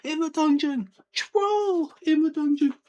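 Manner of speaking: high, slow, loud panicked yell; film-style shout. Retold or imitated. Quirrell charging into the hall, panicked yell. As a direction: bellow the warning as he charges in. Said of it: "properly shouting".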